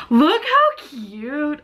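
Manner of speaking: high, loud, stretched-out squeal; excited gushing. Real, not acted. Vlogger showing viewers a cute outfit, excited gushing. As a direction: gush loudly, drawing out the words.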